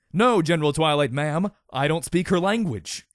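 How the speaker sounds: loud private answering her general; eager reply. A narrator's reading.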